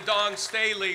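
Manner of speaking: normal talking voice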